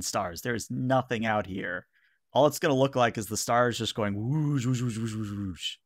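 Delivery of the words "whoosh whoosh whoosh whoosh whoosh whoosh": rapid whoosh-whoosh sound effect; imitated whooshing. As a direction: mimic stars streaking past, trailing off. Imitates stars streaking past.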